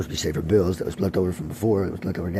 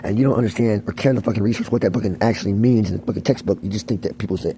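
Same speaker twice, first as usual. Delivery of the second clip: ordinary talk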